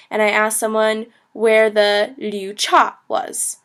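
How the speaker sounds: ordinary delivery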